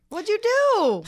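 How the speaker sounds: loud, high-pitched drawn-out question; mock scolding. Co-host reacting to friend's Twitter trouble, mock scolding. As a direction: pitch it way up, playfully accusing.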